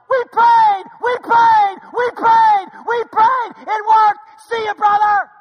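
high, loud triumphant shouting; joyful yell. Retold or imitated. Elder Matt celebrates the car starting, shouting. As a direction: yell it out in victory. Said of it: "All I heard Matt yell was".

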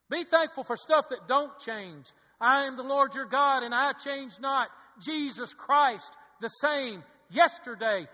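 loud, high, driving preacher delivery; preacher at a ringing peak; full-force preaching. Real, not acted. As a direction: proclaim it at full volume, hammering each phrase.

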